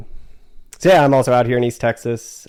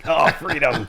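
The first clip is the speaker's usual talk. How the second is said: horse voice; 5 semitones higher than usual. Retold or imitated. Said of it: "They were like".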